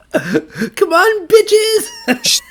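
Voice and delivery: taunting battle cry. Pretend. Mock Toph taunting in fight.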